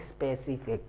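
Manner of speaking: specific said slowly and clearly as a model; model pronunciation. Shown as a demonstration. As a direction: say specific with each sound clear. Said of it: "the right pronunciation is". (सही उच्चारण)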